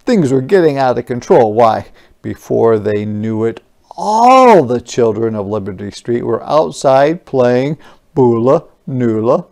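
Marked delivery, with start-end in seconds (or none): none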